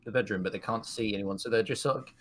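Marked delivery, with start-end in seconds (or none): none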